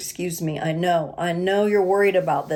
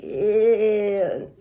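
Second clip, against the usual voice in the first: a short, pitched-up grossed-out "Ew."; mock disgust; 3 semitones higher than usual. Imagining someone from the past showing up, mock disgusted ew. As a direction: recoil from an unwanted visitor, lightly played. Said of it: "you're like".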